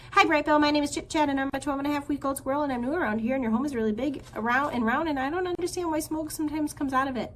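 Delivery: high, fast rambling squirrel voice; young squirrel meets gosling, high, fast nonstop chatter; breathless chatter. Acted. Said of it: "how Chit Chat got enough air".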